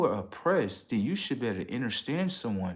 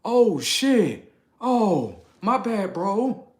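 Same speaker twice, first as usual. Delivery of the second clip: much higher, louder startled backpedal; startled apology; 11 semitones higher, 10 dB louder, much slower than usual. Retold or imitated. People seeing his crew, high startled apology. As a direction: jump back, caught out and sorry. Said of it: "they're like".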